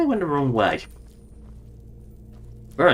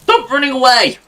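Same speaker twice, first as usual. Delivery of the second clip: shouting; 11 semitones higher, 6 dB louder than usual. Real, not acted. Calling to fleeing companion.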